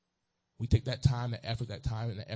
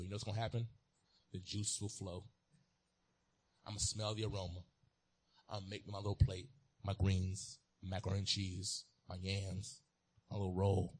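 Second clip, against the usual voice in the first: ordinary delivery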